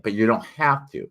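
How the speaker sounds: usual speaking voice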